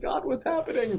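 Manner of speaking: high-pitched comic alarm; mock panic. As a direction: plead upward in pretend panic. Retells panic as hand rises.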